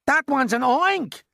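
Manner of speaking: very high, loud, drawn-out shriek; fire chief's scream. A narrator's reading. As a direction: scream it in alarm. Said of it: "screamed the head of the fire department".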